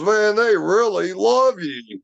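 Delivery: high, slowed, awestruck; awed friend. Retold or imitated. Arnie telling Greg the Bigfoots love him, slow and awed. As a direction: let the wonder stretch the words out. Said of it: "He goes".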